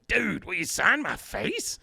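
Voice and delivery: high, slow put-on rocker voice; [name]. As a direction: draw it out as a caricature rock star. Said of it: "oversimplification of the way that [name] speaks".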